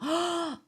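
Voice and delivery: a high, sudden gasp of delight; sharp excited gasp. Real, not acted. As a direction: gasp as if something delicious just appeared. Sees Russian pastry on screen.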